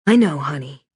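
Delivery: sighing. Acted. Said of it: "Barbara sighed, sniffling".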